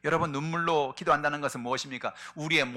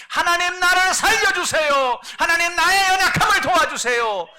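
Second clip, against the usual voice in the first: very high, loud, slow desperate cry; David's tearful prayer; 16 dB louder than usual. Retold or imitated. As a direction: beg God to save you, wailing. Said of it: "눈물로 하나님 앞에 나가서 기도하는". (아주 높고 크게 느리게 울부짖는 기도)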